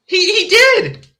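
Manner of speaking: high, halting, worked-up stammer; incredulous protest. Real, not acted. Reader arguing with the story's twist, incredulous. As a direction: stammer it out, unable to believe it.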